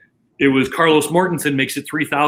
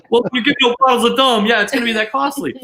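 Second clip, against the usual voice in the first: high, quick teasing through laughter; amused teasing; 7 semitones higher, 3 dB louder, faster than usual. Real, not acted. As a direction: rib him about the bill, lightly. Mocking Phil's shock at bill.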